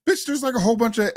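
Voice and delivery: high and fast, incredulous; exasperated outburst. Real, not acted. Co-host reacting to the woman's plea, fast and incredulous. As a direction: fire it off in disbelief.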